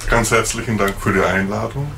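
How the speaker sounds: normal talking voice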